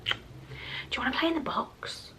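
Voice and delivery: soft breathy whisper; hushed whisper to Bruce; whispering to pet. Real, not acted. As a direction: coax the pet gently. Coaxing the pet softly.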